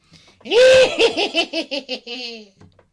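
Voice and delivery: loud, high hammed-up witch cackle; evil cackle. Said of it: "here, I'll try it".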